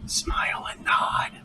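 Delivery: slow stage whisper; whispered aside. Pretend. Game master humoring a player's vampire orc joke, stage whisper. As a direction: whisper it slowly as mock-discreet advice.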